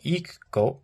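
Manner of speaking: "ikgo" with a sharp glottal stop, air cut off. Shown as a demonstration. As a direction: catch the throat in "ikgo". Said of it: "I cut the air".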